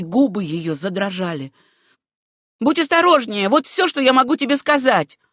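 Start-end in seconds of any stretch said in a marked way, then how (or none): 2.6-5.2 loud, high, insistent warning; a jealous cousin hammering it in; heated warning. A narrator's reading. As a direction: press the warning home.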